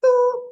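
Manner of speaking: high, loud spoken beep; imitated voicemail beep. Shown as a demonstration. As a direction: mimic an answering machine beep.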